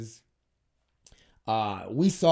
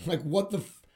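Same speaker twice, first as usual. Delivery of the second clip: exasperated, higher-pitched, cut-off outburst; getting angry; 6 semitones higher than usual. Real, not acted. Podcaster riled by his own theory, getting angry. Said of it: "now I'm getting angry".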